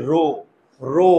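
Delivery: রো said with jaw nearly closed, ending in an o sound; rounded ro. Shown as a demonstration. Teacher shows pupils the closed-jaw রো. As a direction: keep the jaw shut and say রো as ro. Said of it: "মুখের চোয়াল খুলে না".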